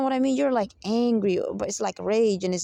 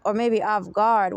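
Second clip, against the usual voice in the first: normal talking voice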